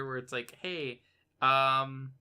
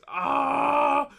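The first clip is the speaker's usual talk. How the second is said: mock shocked yelp; 13 semitones higher, 8 dB louder than usual.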